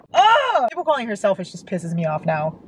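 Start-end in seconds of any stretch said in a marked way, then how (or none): 0.1-0.7 high-pitched cry of exasperation; exasperated groan. Real, not acted. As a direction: let out a high, frustrated "ugh". Angry at readers calling Lily selfish.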